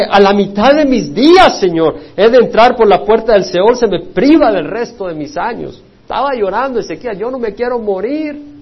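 loud, high reading of the scripture; preaching at full force. Acted. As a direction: proclaim the verses with rising fervor.

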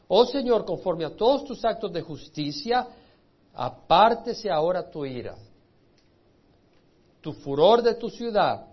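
loud, pleading proclamation of scripture; fervent prayer reading. Acted. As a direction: cry the plea out to God from the pulpit. Reads Daniel's plea aloud.

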